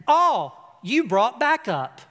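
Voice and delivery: slow, loud cocky jab; drawn-out mocking jab at the bigger guys; cocky taunt. Kid mocks the bully and his two brothers, slow cocky taunt.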